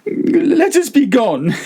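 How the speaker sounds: high, slow pleading voice; desperate Fortunato. A narrator's reading. Prisoner begging his captor to go home, high and pleading. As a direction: beg to leave, voice climbing.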